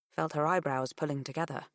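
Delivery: usual speaking voice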